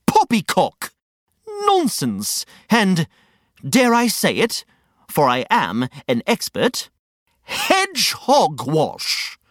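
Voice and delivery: pompous ranter, drawing out each insult; theatrical huffy rant, slow on key words; indignant Reg, carried away. Acted. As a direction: declare it grandly, with pauses between the words. Character rants with synonyms.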